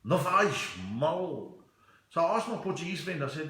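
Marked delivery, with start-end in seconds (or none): 0.0-1.5 slow, drawn-out moody take. Shown as a demonstration. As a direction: drag it out in a mood. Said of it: "If you're a murin, you go like".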